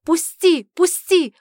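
high, desperate shouts to be released; frantic yelling; loud cry. Acted. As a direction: cry out sharply, fighting to get free. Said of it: "Мой громкий крик".

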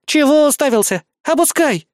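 put-on high, loud irritated girl. A narrator's reading. Carried girl to the man staring at her, irritated. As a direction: order him with sharp annoyance. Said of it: "раздражённо произнесла она".

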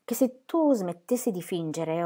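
usual speaking voice

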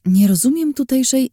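taken aback mid-sentence; startled. Acted. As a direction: voice jumps in surprise. Narrator cut off, startled.

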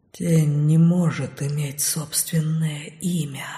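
slow, low breathy whisper. A narrator's reading. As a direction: hush it like a shadow. Shadows whisper. (шёпот теней)

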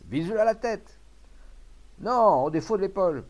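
loud, high, urgent calls; excited onlookers calling out; shouting advice. A narrator's reading. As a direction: argue over the aim from the crowd.

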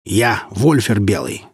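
slow, mildly barked declaration. A narrator's reading. Northern warrior announcing himself to bandits, slow bark. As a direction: bark your name out slowly. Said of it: "рявкнул северянин".

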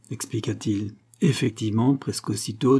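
plain reading voice

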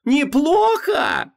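very high, drawn-out furious scream; wild yell. A narrator's reading. Wife throwing his word back, screaming wildly. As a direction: scream it in disbelief, stretching the word. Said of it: "дико заорала Ванесса".

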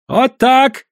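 high, loud exclamation; triumphant cry. A narrator's reading. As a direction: crow over the throw. Triumphant throw in gambling fight.

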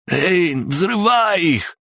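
very high, drawn-out yell; slow, high-pitched hoarse shout; hoarse desperate cry. A narrator's reading. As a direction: scream the order with a worn-out throat. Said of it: "закричал он осипшим голосом".

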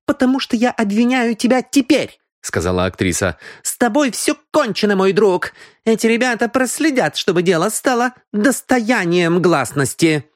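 high, resolute woman's voice; accusing actress. Acted. Actress tells the cheat he is finished, resolute. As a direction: pronounce his doom in a high voice.

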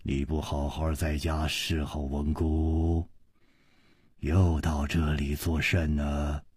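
low, soft, commanding voice; deep stern father. A narrator's reading. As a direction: speak like a man used to giving orders. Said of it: "一个人沉声说". (父亲沉声质问女儿)

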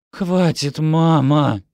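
exasperated cry for it to stop; protesting. A narrator's reading. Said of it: "запротестовала Декла".

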